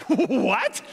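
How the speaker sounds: high, loud, incredulous yelp; comic outburst of surprise; mock disbelief. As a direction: react as if baffled by the anticlimax. Mock disbelief at anticlimactic verse.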